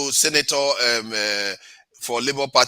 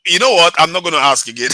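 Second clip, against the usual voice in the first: loud, playful scolding; mock exasperation; 4 semitones higher, 8 dB louder than usual. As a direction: pretend to lose patience. Host mock scolds a guest.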